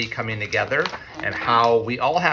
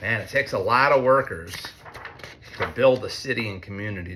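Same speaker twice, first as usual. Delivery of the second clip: ordinary delivery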